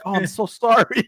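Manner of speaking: high-pitched imitated apology; apologetic woman. Imitating the woman apologizing to the bagger, apologetic.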